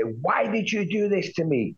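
high, pleading retold anger; livid demand. To the absent contact, high and livid. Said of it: "'cause I was so livid".

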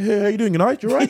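high, loud chirpy hello; exaggerated polite greeting, half laughing. Shown as a demonstration.

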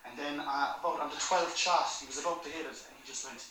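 normal talking voice

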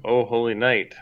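usual speaking voice

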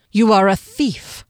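loud, ominous accusation; stern condemning voice; menacing guard. A narrator's reading. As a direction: accuse as a ghostly sentinel.

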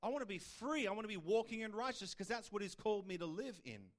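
usual speaking voice

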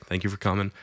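softly imitated polite thanks; polite preacher. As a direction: toss off a quiet, courteous thanks. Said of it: "very polite".

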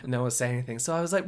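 ordinary talk, nothing marked

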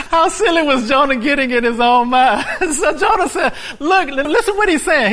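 high, laughing mockery of Jonah. Real, not acted. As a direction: laugh through the words.

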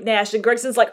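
normal talking voice

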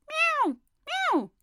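high loud meow meow; impatient cat cry. A narrator's reading. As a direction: cut in with a cat's meow. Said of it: "interrupted Simpkin".

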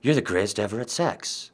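very soft, low murmur; flattering woman. Acted. Woman flattering her man right after sex, hushed murmur. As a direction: murmur the praise under your breath. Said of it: "the woman would be like".

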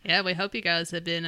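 usual speaking voice

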